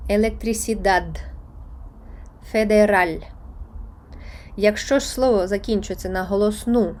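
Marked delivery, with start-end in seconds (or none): 0.1-1.2 електрисідад slow, stressed on final dad; last-syllable stress. Shown as a demonstration. Teacher giving an example of the rule, електрисідад stressed last. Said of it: "наголос падає на останній склад". 2.5-3.2 федераль loud, final syllable punched; last-syllable stress. Shown as a demonstration. Teacher giving an example of the rule, федераль stressed last. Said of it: "наголос падає на останній склад".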